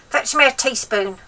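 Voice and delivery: put-on high, loud cranky old woman; grandma voice. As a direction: nag the boy like a spiteful old grandma.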